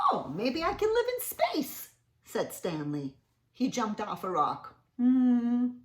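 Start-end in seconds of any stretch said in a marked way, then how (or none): 0.1-1.9 high hopeful character voice; Stanley's voice. Acted. Reading to kids, hopeful Stanley wondering where to live. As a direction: lift the pitch for hopeful Stanley.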